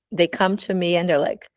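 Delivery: ordinary delivery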